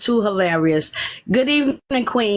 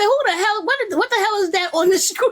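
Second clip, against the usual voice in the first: husband laughing in disbelief; 9 semitones higher than usual. Retold or imitated. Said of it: "He said".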